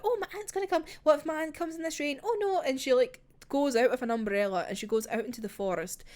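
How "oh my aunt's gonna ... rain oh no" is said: put-on higher, fast fretting child voice; a little girl worried about the rain. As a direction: fret like a small anxious girl. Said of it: "she's like".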